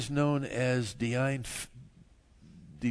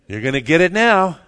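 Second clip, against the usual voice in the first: loud, raised-pitch playful warning; mock threat; 6 semitones higher, 16 dB louder than usual. Preacher guessing what Jesus says to rebel armies, mock threat. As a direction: threaten loudly, as a joke. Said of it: "Probably just something like".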